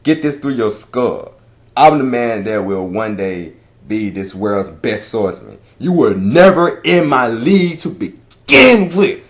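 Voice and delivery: slow, epic declaration; slow dramatic swordsman speech, building at the end; Zoro. Acted. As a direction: deliver it as a weighty boast, word by word. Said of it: "Zoro says the most epic line".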